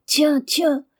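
high, loud startled cry; sudden alarmed exclamation; cry of fright. A narrator's reading. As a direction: cry out as if seeing it appear. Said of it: "jeter un cri étouffé".

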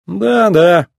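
careless, offhand. A narrator's reading. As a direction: brush it off casually. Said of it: "небрежно ответил Маккуин".

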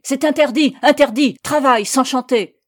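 high, very loud repeated ban; enraged, almost choked. A narrator's reading. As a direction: shout orders, beside yourself. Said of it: "l'enragé d'une voix presque étranglée". (cris enragés, presque étranglés)

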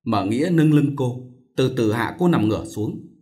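plain reading voice

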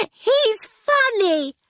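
put-on very high, slow, childlike; delighted little girl. A narrator's reading. Orphle telling Howard about Torquil, high and slow. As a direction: say it with glee.

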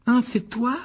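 high-pitched disdainful question. A narrator's reading. Ron greets Malfoy in the shop, disdainful. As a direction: greet them like dirt on your shoe. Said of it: "comme s'il s'était agi d'une saleté".

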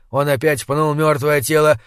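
ordinary delivery

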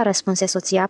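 ordinary delivery, nothing marked

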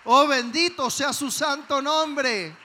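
slow, loud cry of praise. Real, not acted. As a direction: call out the blessing to the whole church. Preacher exclaims praise.

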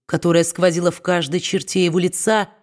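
ordinary delivery

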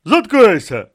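loud, high snapped command; brusque order to shut up; curt cut-off. Acted. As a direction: cut the speaker off sharply. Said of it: "відрізав, не озираючись".

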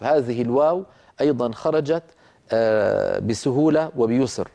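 normal talking voice

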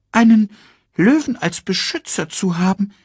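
erst hoch und laut, dann grübelnd ruhiger; nachdenkliches Erdmännchen. Acted. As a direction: Timon überlegt es sich anders. Timon denkt um. (leicht gespielter Timon)

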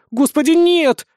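high, loud cry of dismay; desperate plea. A narrator's reading. Witch refusing the boy's suggestion, desperate plea. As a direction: implore him, horrified at the idea. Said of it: "взмолилась Гертруда".

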